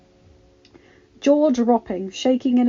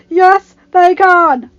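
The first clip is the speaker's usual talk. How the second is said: high, slow, full-force yell; very loud, stretched-out retort; defiant shout; 10 semitones higher, 14 dB louder, much slower than usual. Acted. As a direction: shout back in stubborn defiance. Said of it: "the Lord of Grey Water Watch shouted".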